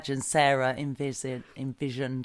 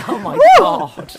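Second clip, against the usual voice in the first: shrieking laugh; 14 dB louder, slower than usual. Real, not acted. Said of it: "reacting to peacock call".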